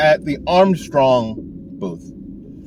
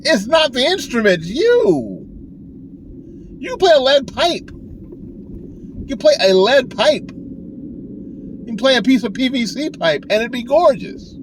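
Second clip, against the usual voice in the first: mock excited retort; 11 semitones higher than usual. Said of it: "And we went".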